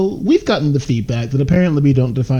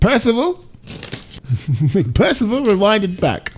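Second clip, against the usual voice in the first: playful call; 11 semitones higher than usual. Pretend. Playfully calling the editor.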